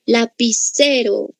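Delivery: "Lapicero" slowly, la-pi-CE-ro; stress on penultimate syllable. Shown as a demonstration. Teacher showing the stressed syllable, "Lapicero" slow with CE stressed. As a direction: stretch CE in "Lapicero". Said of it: "penúltima sílaba".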